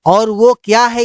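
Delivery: normal talking voice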